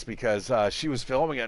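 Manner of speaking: ordinary talk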